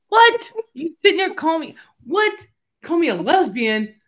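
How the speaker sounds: high-pitched indignant disbelief; mock outrage. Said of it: "I was mad".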